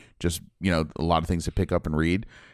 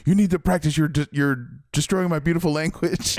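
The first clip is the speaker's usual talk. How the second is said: irritated Nazi officer; 9 semitones higher than usual. Retold or imitated. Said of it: "he's like".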